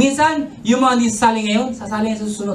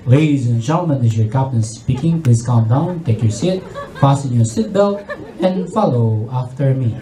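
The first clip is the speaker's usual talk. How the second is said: much lower, slow calm pilot voice sliding into prayer; captain ending the announcement with a prayer; composed captain; 8 semitones lower than usual. Retold or imitated. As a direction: keep calm, then lead a prayer. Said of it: "And still composed".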